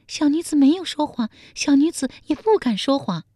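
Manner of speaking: high-pitched, fearful pleading; terrified palace maid. A narrator's reading. Maid denies lying to the emperor, terrified. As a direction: plead innocence in a high maid's voice. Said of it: "宫女面如涂蜡说".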